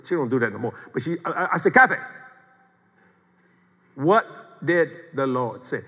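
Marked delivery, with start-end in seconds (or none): 1.7-5.8 measured, insistent "what ... did ... the Lord say"; pointed questioning. Retold or imitated. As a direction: press the question, a gap after each word. Insistent repeat of question to wife.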